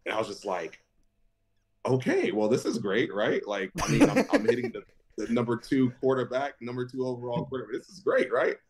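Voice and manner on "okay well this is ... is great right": sarcastic panic. Retold or imitated. Seeing the injury on ESPN, high sarcastic panic. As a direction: fake optimism while panicking.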